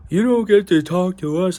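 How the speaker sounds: put-on voice mocking his bluster; mock tough guy. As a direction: mimic his would-be tough challenge. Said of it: "tries to get all fucking hard".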